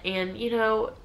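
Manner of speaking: ordinary talk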